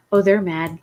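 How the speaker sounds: ordinary delivery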